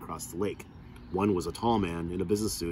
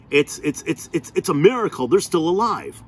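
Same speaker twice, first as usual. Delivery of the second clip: high, loud stammering astonishment; stammering amazement; 9 semitones higher, 8 dB louder than usual. Acted. Tall man stammering over the boys surviving, amazed and loud. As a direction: stumble over it in shock.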